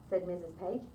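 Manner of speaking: ordinary delivery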